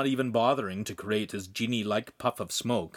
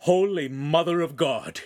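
gasped; 5 semitones higher, 4 dB louder than usual. A narrator's reading. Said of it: "he gasped".

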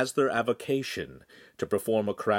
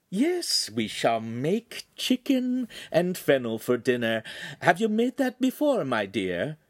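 lilting, higher friendly lady; friendly sing-song; 7 semitones higher than usual. Acted. Signora chatting to her new kitchen girl, sing-song. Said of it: "in a friendly, sing-song manner".

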